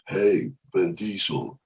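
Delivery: low action-star drawl; Vin Diesel impression. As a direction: drag it out deep like Vin Diesel. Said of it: "I'm trying to do".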